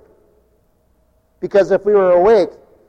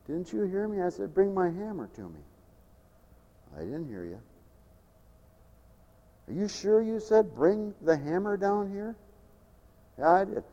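drowsy; 15 dB quieter than usual. Retold or imitated. Sleepy worker dialogue.

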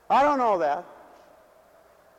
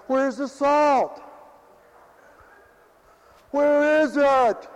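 higher, louder, slow mock complaint; mock demanding; 8 semitones higher, 5 dB louder, much slower than usual. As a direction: call out for the missing salt. Congregants demanding missing salt.

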